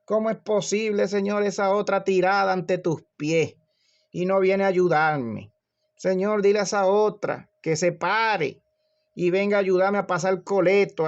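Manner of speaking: high-pitched, indignant shouted complaint; complaining yell. Acted. Martha yells from the kitchen to the Lord, indignant shouted complaint. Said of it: "Marta le gritaba al Señor".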